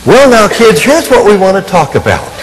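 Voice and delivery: hammed-up dad settling in to lecture; mock dad lecture. Father on tape starting a talk with his kids, hammed-up stern.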